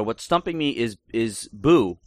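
ordinary delivery

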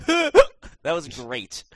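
slow words through laughter; laughing. Real, not acted. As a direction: gasp it out while laughing. Still laughing after the hiccup.

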